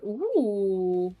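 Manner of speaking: ordinary talk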